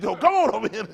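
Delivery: loud, high imitation of calling over; mock calling out. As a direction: holler an invitation, hammed up. Imitates cousin calling someone over.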